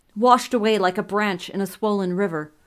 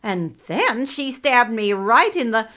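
loud, high, jumping between frantic voices; possessed babbling; 5 semitones higher, 7 dB louder than usual. A narrator's reading. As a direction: blurt fragments wildly, swelling into a shriek. Many souls babble through king.

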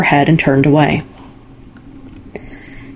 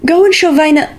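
eager mother; 11 semitones higher than usual. A narrator's reading. Mother urging Aino excitedly.

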